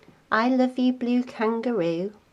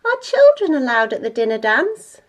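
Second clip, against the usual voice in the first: put-on higher, louder hopeful kid question; lightly voiced child's question; 4 semitones higher, 5 dB louder than usual. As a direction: lightly play an eager child asking. Child asks in story.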